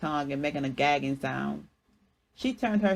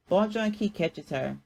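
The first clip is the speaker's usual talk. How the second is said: ordinary delivery, nothing marked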